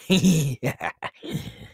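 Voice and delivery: high, gleeful cackle; evil giggle. Acted. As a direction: giggle after a gruesome threat. Laughs after stabbing threat.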